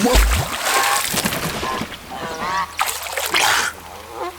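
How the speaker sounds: breathy, surprised high laugh; a caught-off-guard chuckle; startled laugh. Acted. Bathing man laughing when Eris greets him.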